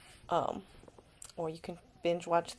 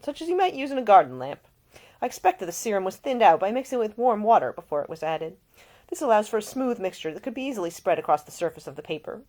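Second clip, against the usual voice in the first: lightly voiced earl explaining, a bit louder; a hint of lecturing lord; 5 semitones higher, 12 dB louder than usual. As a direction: lecture the young man on potion ink. Nick lecturing about potions.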